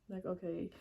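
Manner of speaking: ordinary delivery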